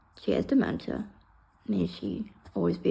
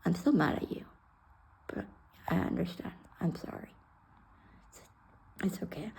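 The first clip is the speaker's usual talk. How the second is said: soft apology; 8 dB quieter than usual. Retold or imitated. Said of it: "and she says".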